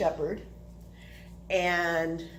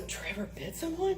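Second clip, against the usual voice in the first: hushed, higher-pitched dread; mock concern in a small raised voice; worried question; 7 semitones higher, 6 dB quieter than usual. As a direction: ask softly, fearing bad news. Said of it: "he's going, "You know, this could be bad".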